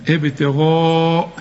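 ابتغاء slowly with a full heavy ghayn. Shown as a demonstration. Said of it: "الغين بقى أعلى درجات التفخيم".

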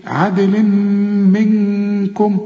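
عدل منكم drawn out, ن softened into a hum before ك; hidden nun. Shown as a demonstration. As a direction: slowly hold both hums in عدل منكم. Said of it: "والكاف من حروف الإخفاء".

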